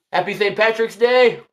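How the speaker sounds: usual speaking voice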